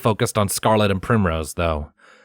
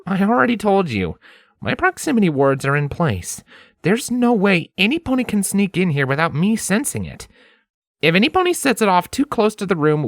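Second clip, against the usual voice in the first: raised voice, confident and calming; Lens, reassuring; 11 semitones higher than usual. A narrator's reading. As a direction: settle her worries. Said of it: "a reassuring smile".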